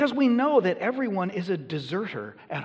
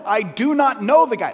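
much higher and louder, hit "know" hard in denial; emphatic denial; 7 semitones higher, 11 dB louder than usual. Retold or imitated. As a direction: deny it with everything, punching "know". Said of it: "he is so emphatic".

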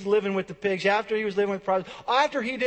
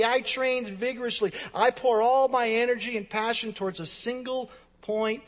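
higher, emphatic preacher's build; driven intensity; 4 semitones higher than usual. Acted. Preacher voicing Paul pouring out all his energy, driven intensity. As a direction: drive each phrase home without letting up.

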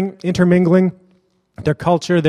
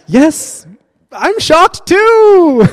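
high, very slow mock shock, much louder; sarcastic; 11 semitones higher, 6 dB louder, much slower than usual. Answering the woman with hammed-up sarcastic shock. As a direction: drawl it out in fake astonishment. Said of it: "I'm like".

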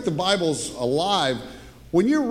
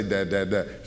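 put-on baby babbling; 11 semitones lower, 5 dB quieter than usual. Baby's first words.

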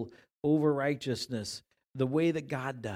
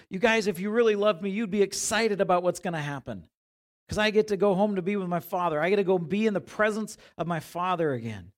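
fast, louder eager plea to friends; excited Jesus; 5 semitones higher, 6 dB louder than usual. Retold or imitated. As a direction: urge the disciples, thrilled about going home. Jesus voiced to disciples.

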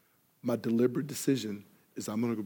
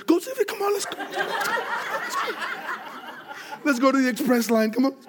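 far higher, laughing and frantic; hammed-up fleeing, pleading to hurry; mock panic; 5 dB louder than usual. Husband hurrying Sylvia to the express line, laughing mock panic.

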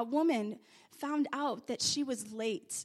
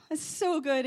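usual speaking voice

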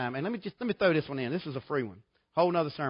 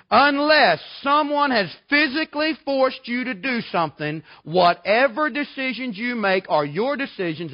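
preaching at full force; 6 semitones higher, 6 dB louder than usual. Acted. Preaching climax on responsibility.

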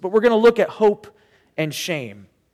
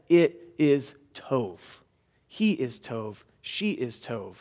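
slow, soft and lower, full of care; tender voice of God; 7 semitones lower, 7 dB quieter, slower than usual. Retold or imitated. Pastor voicing God's verdict on people, slow and tender. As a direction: say it like God blessing a child. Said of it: "he looked at me and he said".

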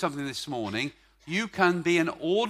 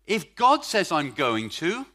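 posh Oxford version; 2 semitones higher, 7 dB louder, slower than usual. Shown as a demonstration. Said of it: "that's the Oxford version".

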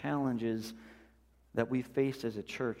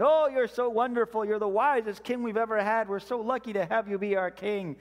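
high, gushing mock flattery; fawning courtiers; 8 semitones higher, 4 dB louder than usual. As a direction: lay the praise on thick like a courtier. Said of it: "always flattering him".

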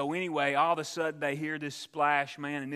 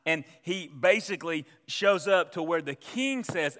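ordinary talk, nothing marked

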